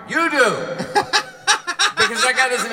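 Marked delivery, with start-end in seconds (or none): 0.1-0.7 loud jab. Real, not acted. Mocking the ad's premise. 0.7-1.9 cracking up at his own jab; loud delighted laugh; high burst of laughter. Real, not acted.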